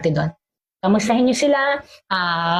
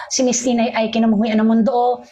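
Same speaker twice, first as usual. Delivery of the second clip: ordinary delivery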